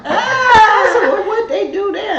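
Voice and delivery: high laughing shout, half crying. Retold or imitated. Mother asks about Magic City, laughing.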